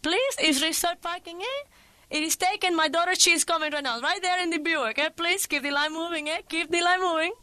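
put-on high, bold Cuban-accented call to drivers. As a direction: wave them on in a Cuban accent. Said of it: "and cry out".

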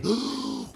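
sharp gasp of fright; startled gasp. Acted. Mommy finding a bear at the door, frightened gasp. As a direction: gasp as if seeing something scary.

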